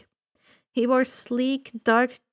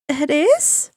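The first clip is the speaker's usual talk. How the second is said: surprised question; 4 semitones higher, 5 dB louder than usual. Acted. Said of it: "she asked".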